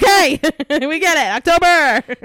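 loud, high, fed-up laughing groan; mock the tired parents, laughing; exasperated parents. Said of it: "my parents were like".

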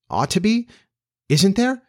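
put-on high, rising questions in a girl's voice; puzzled questioning. A narrator's reading. Girl querying what the boy said, surprised. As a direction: query it in surprise, pitch leaping up. Wendy questions Peter's phrase.

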